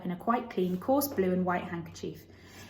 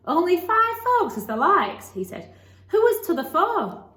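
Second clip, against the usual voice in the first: put-on higher, slow broad Yorkshire boy; Yorkshire lad; 10 semitones higher, 7 dB louder than usual. A narrator's reading. Dickon teases Mary about liking five people, broad Yorkshire lad. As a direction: play the cheerful country lad, drawn out.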